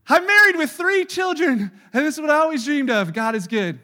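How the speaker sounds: high, loud hammed-up delight; loud mocking gush. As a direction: parody a beaming believer. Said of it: "what I hear too often is like".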